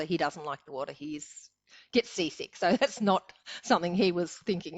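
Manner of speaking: ordinary talk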